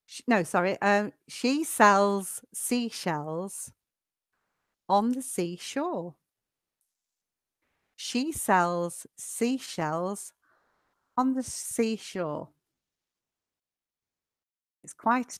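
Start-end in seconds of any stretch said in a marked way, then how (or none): 1.3-6.2 slow, carefully separated tongue twister; slow modelling. Shown as a demonstration. Teacher models a tongue twister for sh and s, slowly. 8.0-12.5 slow tongue twister in two careful phrases; slow modelling. Shown as a demonstration. Teacher repeats the tongue twister for students, slowly.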